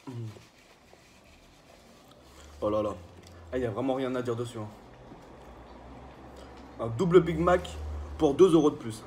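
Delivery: chewing while talking; talking between bites; mouth full. Real, not acted. Seen 3: bites burger at +0.2, keeps chewing with food at mouth.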